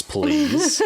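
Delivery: high squeaky "please"; a sudden squeaky polite ask. Retold or imitated. Rick retells asking librarian.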